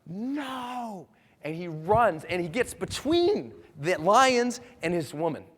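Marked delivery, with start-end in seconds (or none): 0.1-1.1 high, drawn-out acted no; long cry held back in volume; desperate cry. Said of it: "he jumps and he's like".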